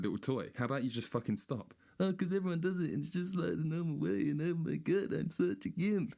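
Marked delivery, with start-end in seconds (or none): 2.0-6.2 high whiny mock voice; mock phone addict. Comedian mocking phone addicts, high whiny voice. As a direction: ham up a dim phone addict. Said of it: "That's how you sound to me".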